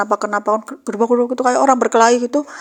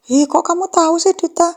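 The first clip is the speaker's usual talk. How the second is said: amazed friend; 8 semitones higher, 3 dB louder than usual. Retold or imitated. Friend amazed at Dita's guess.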